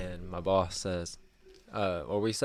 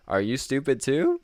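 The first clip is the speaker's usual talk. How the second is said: higher, louder mocking question; imitated insulting customer; 6 semitones higher, 7 dB louder than usual. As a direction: hurl it as a scornful retold question. Rude customer retold insulting boss.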